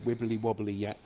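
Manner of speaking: normal talking voice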